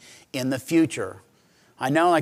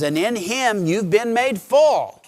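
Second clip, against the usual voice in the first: slow and loud, rising high; slow proclaimed promise; proclaiming; 7 semitones higher, 6 dB louder, slower than usual. Acted. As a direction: lift it like a triumphant verse. Proclaiming scripture triumphantly.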